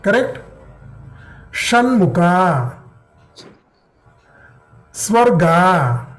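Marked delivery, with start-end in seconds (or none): 1.5-6.1 Shanmukha drawn out slowly, retroflex sha; teacher modelling Shanmukha slowly; slow demo. Shown as a demonstration.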